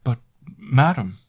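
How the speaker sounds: slow, high, loud hesitant protest; stammering maid. A narrator's reading. Maid nervously protesting to her mistress, stammering. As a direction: stumble over it, afraid to contradict. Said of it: "stammered Annette".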